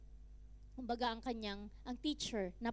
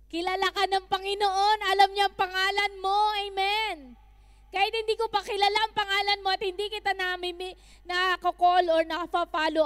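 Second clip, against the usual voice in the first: raised, ringing assurance to the congregation; fervent preaching; 7 semitones higher, 10 dB louder than usual. Acted. Pastor assuring the congregation God knows them, fervent preaching. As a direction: preach it out loud and sure.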